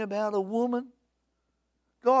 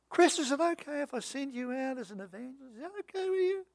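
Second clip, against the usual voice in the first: lightly voiced God; 5 semitones higher, 5 dB quieter, faster than usual. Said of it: "God voiced asking preacher".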